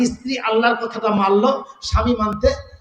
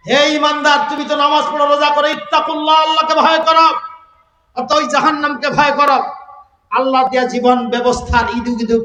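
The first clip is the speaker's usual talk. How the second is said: raised, ringing sermon voice, higher and louder; fiery preaching; 4 semitones higher, 4 dB louder than usual. Acted. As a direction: thunder the warning at the believers. Preaching at full force. (উচ্চস্বরে জোরালো ওয়াজ)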